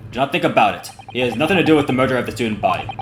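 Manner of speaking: lightly voiced Russian guard, a touch proud; a hint of a Russian accent, talking up the gentleman. Guard praising the passenger next door, light Russian accent. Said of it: "me voicing him is also a little bit stereotypical".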